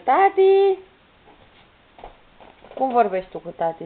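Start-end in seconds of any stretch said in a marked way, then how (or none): 0.0-0.8 high, loud, drawn-out call; strigat tare. Shown as a demonstration. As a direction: shout for daddy, voice leaping up. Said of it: "Ia strigă". (strigă tare, pe ton înalt)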